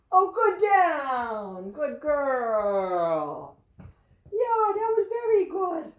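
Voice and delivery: high, loud dog praise; delighted praise for the good dog; gushing praise. Real, not acted. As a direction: rave over the dog, way up high.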